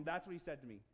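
ordinary talk, nothing marked

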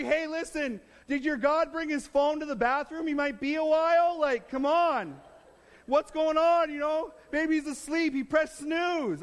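high-pitched, playful taunt; mocking. Preacher paraphrasing Elijah's jibes at the prophets, mocking. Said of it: "as he mocks them".

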